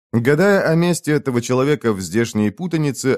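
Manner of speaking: usual speaking voice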